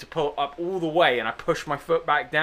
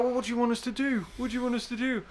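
helpless friends; 7 semitones higher, 8 dB quieter, faster than usual. Retold or imitated.

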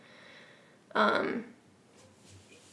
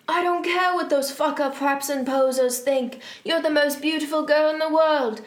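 raised, higher impassioned declaration; sad shout; 4 semitones higher, 4 dB louder than usual. Acted. Draco pouring out his love to Ebony, hammed-up anguished speech. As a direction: pour it out melodramatically, pleading. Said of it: "he shouted sadly".

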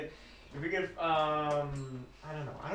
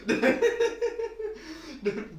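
laughing; 9 semitones higher than usual. Real, not acted. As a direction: talk through laughter. Laughing joke.

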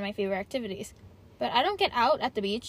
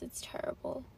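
whisper; 8 dB quieter than usual. Real, not acted. Nervous aside.

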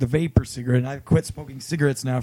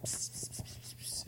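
hissed whisper psst; 19 dB quieter than usual. Retold or imitated. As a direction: psst psst whispered under breath. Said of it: "being talkative".

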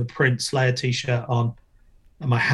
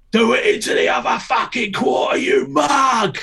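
retold shout; 11 semitones higher than usual. Coach figure yelling at a skater to go bigger. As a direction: bellow it at the skater. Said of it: "he started shouting".

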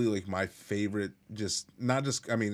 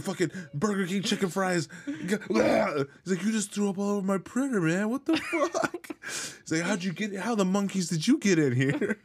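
mock shouting stoner; 10 semitones higher than usual. Acting out movie scene, high.

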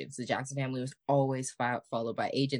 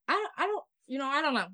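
baffled; 10 semitones higher, faster than usual. Real, not acted. Baffled high exclamation.

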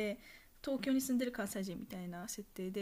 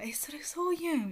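higher, louder questioning reaction; mock surprise; 7 semitones higher, 5 dB louder than usual. Imagined listener reacting to an unfamiliar dialect word, mock surprise. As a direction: act out a mildly incredulous double-take.

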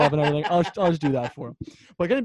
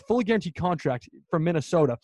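ordinary talk

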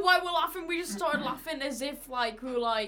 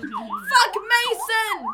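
slow, loud, high yell; shouting; 10 semitones higher, 7 dB louder, much slower than usual. Real, not acted. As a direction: drag it out in a yell. Friends teasing, yelling at Mason.

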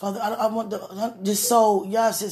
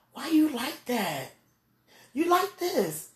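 slow, much higher tease; high teasing question; 8 semitones higher, slower than usual. Real, not acted. Asking God why he likes it, much higher tease. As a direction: lift it up, playful.

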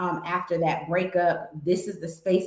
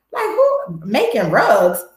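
a high, disbelieving "who?" then a slow, louder "Making rugs?"; surprised; 3 semitones higher, 5 dB louder, slower than usual. Pretend. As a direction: act surprised at yourself, high then drawn out. Mock surprise at rug class.

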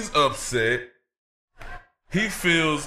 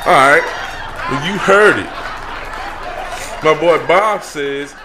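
louder, booming relay to the crowd; loud announcement; 6 dB louder than usual. Acted. Host announcing what his silent bodyguard said, loud and booming. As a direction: boom it out for the arena.

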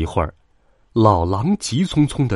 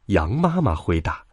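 ordinary delivery, nothing marked

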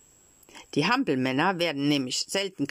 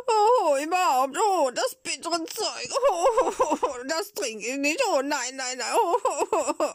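sobbing grumble; 11 semitones higher, slower than usual. Acted. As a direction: grumble through exaggerated childish sobs. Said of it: "laut anzuschluchzen, und zwischen den Schluchzern grollte er". (schluchzend grollen, hoch und jammernd)

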